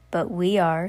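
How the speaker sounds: usual speaking voice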